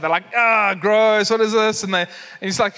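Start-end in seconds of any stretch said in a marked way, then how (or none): 0.4-1.8 louder mock disgust of kids; grossed out. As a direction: imitate schoolkids recoiling in disgust. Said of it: "and they're grossed out".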